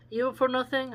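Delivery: intrigued query; curious question. Real, not acted. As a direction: quiz the phrase out loud. New phrase surprises him.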